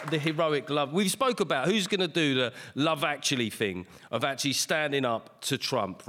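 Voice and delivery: ordinary talk, nothing marked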